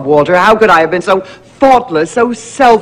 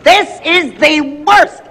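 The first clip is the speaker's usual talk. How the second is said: higher, slow, theatrical wail; dramatic despair; 9 semitones higher, slower than usual. Acted. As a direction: moan it like a tragedy. Repeated for dramatic effect.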